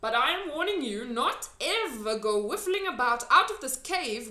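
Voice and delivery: a hint of a stern giant; warning giant. A narrator's reading. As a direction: warn her gravely as the giant.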